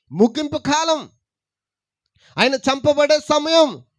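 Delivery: preaching emphasis. Acted. Preaching, rising to climax.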